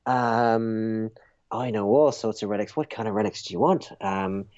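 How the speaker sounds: shady relic seller's sales patter; relic peddler. Acted. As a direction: dangle Christ's finger bones like prized stock.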